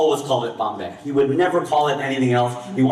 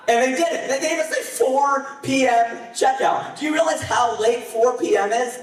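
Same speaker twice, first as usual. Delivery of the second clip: incredulous; 10 semitones higher than usual. Real, not acted. Incredulous about late checkout.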